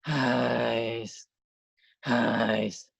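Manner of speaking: long breathy sighed "highs"; slow falling exhale with airy H; demonstrated sigh. Shown as a demonstration. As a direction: sigh out "highs" to show the airflow. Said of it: "when we sigh".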